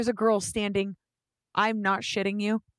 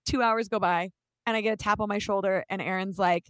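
usual speaking voice

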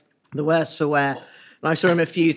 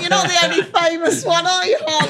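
laughing quote; 13 semitones higher, 3 dB louder than usual. Retold or imitated. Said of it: "Neil was just like".

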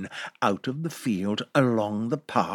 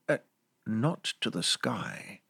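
usual speaking voice

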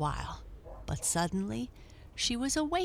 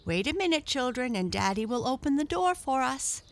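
put-on higher-pitched mother calling to children; lady's voice; 7 semitones higher, 3 dB louder, faster than usual. A narrator's reading. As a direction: speak high, as a mother to her children. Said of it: "said a lady's voice".

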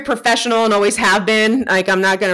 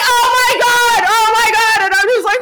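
hammed-up fangirl panic; starstruck panic; 12 semitones higher, 4 dB louder than usual. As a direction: repeat it in a high retold freak-out. Re-enacts panic at meeting the star.

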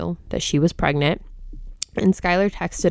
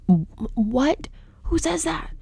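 high-pitched, breath-thin incredulous questions; appalled disbelief; 7 semitones higher than usual. Real, not acted. Podcast host reacting to the mother's text, appalled. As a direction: voice drops away into stunned, whispery disbelief.

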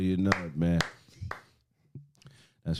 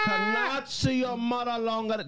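high, slow mock Chinese accent; 10 semitones higher, slower than usual. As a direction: fake Chinese accent, stretched out. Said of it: "y'all can't even do a Chinese accent".

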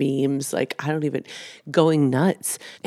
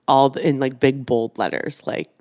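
normal talking voice

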